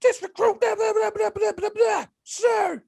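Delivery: very high-pitched recruit shout; Ramsey. Retold or imitated. Big recruit addressing drill instructor, high-pitched shout. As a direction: shout it high, running into rapid gibberish. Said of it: "he had this voice like".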